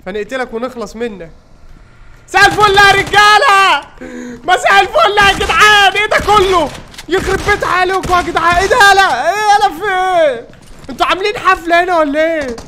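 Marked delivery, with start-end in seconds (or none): 2.3-3.9 loud, high, drawn-out taunting hello; mock cheerful greeting shout. Gamer bursts in on enemies, shouting slow high mock hello. 4.4-12.6 mock outraged shouting. Gamer yells at enemy crowd in loud, high mock outrage.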